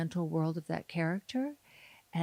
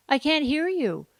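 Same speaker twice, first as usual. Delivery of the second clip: raised, high-pitched protest; loud complaint; 8 semitones higher, 8 dB louder than usual. Retold or imitated. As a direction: call it out loudly at a mumbler. Loud protest at mumbling actors.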